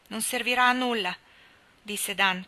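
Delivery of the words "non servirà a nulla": failed defiance. A narrator's reading. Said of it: "cercò di assumere un tono di sfida".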